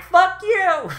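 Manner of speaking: high, harsh mock shout; angry creature yell. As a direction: snap it out in a squeaky voice. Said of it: "Kyubei's just like".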